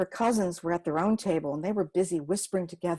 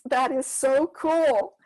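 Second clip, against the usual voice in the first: slow, drawn-out, higher and louder wonder; amazed kid; 6 semitones higher, 6 dB louder, much slower than usual. Acted. Older boy marveling at Gima's shivaree, slow and amazed. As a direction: stretch it out like a kid in awe.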